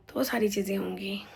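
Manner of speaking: whispered aside. Real, not acted. Vlogger confiding her worries to viewers, whispering. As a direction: breathe it almost without voice.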